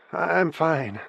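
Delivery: high, shaky and thin; trembling voice. A narrator's reading. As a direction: voice trembling, holding back tears. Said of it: "she said in a trembling voice".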